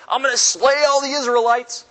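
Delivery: high, loud, booming threat; the Lord threatens to wipe out Israel, loud and high; angry God. Retold or imitated. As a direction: raise the voice and threaten like a furious God. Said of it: "and the Lord again says".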